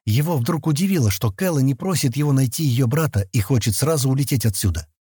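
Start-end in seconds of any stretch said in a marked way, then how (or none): none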